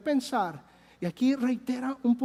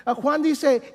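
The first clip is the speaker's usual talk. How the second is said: ordinary talk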